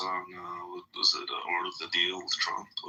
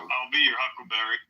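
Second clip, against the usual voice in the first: movie gunslinger line; 3 semitones higher than usual. Acted.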